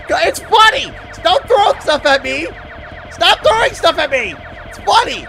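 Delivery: high, loud yelling; loud high-pitched shouts; shouted protest. Real, not acted. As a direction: yell it indignantly at whoever is throwing things. Protesting at things thrown at them.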